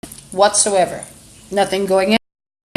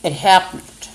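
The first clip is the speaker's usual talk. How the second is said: talking while chewing; words round a bite of food; mouth full. Real, not acted. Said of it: "Sorry to talk with my mouth full".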